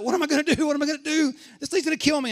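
high, loud acted panic; freaking out. Man in gorilla suit beside a lion, panicking. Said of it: "by now he's freaking out".

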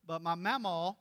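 ordinary delivery

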